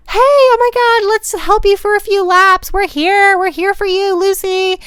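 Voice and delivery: high, loud bubbly cheer; squealing, high-pitched encouragement; perky and upbeat. Retold or imitated. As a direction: play the tipsy cheerleader friends. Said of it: "they're so perky and".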